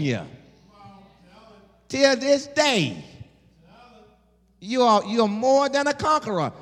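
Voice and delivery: high, slow, rising preacher cadence; preaching fervor. Acted. Preacher telling his church God goes before them, fervent. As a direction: build it up for the congregation.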